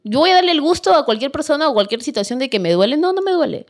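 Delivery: high, proud refusal to cry, rising in pitch; mock-tough self talking herself out of tears; stubborn scoff, voice jumping high; defiant inner vow. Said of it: "me lo tragaba porque decía".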